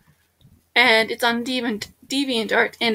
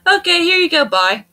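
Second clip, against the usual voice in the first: breezy send-off; 6 semitones higher, 5 dB louder than usual. Pretend. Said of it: "be like".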